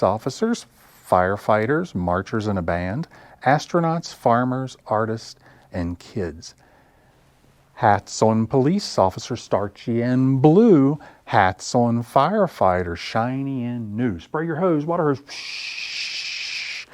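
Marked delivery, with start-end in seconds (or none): 3.4-15.2 sing-song nursery rhyme with stretched line endings; children's rhyme recital. Acted. 15.2-16.9 long hissing "psh" of spraying water; hose spray sound. Acted.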